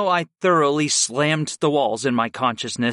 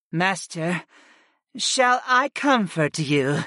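high, slow offer of comfort; wondering goddess; 6 semitones higher, slower than usual. A narrator's reading. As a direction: ask it slowly in a high goddess voice. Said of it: "Mira Lee wondered".

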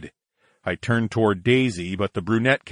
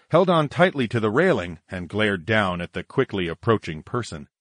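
usual speaking voice

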